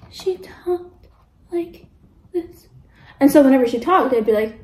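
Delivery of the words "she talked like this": very soft, slow mumble; mock a friend who barely gets words out; imitated mumbling. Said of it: "they're mumbling. They're mumbling back".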